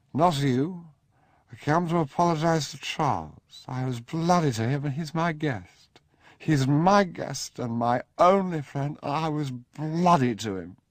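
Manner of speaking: put-on loud, high, slurring drunken insistence; drunk outburst. Drunk Sebastian rebuffing his mother, loud and high. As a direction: shout it at the family, swaying and stubborn.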